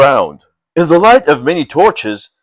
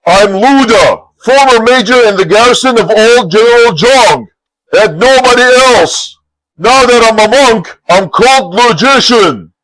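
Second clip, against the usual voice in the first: proud declaration; 7 semitones higher, 4 dB louder than usual. Acted. Monk declaring his name to the bandit, proud. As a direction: announce yourself grandly.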